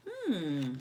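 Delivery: plain reading voice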